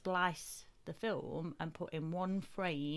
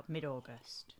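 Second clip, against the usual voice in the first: whispered; 3 semitones lower, 4 dB quieter, slower than usual. Real, not acted. Quiet aside.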